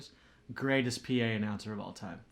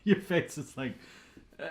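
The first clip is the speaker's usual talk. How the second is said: laughing; 8 semitones higher, 10 dB louder than usual. Real, not acted. Laughing at cohost's face.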